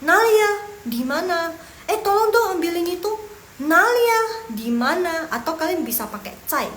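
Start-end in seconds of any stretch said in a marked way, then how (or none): 0.1-1.5 high, loud call asking where; demonstrating a called-out question; acted question. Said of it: "Misalnya mau tanyain orang nih". 1.9-5.3 high, loud request to someone; raised-voice role-play of asking; acted question. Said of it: "Misalnya mau tanyain orang nih".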